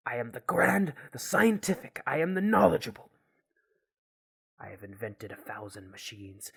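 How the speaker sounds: grand declaiming, swelling into loud bursts; proud, indignant scientist. Acted. [name] insists he is a doctor, indignant grandiosity. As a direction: proclaim your greatness in emphatic, separate phrases.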